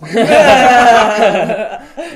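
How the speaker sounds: long, high burst of laughter; real loud laugh. Real, not acted. Player joke sets off laughter.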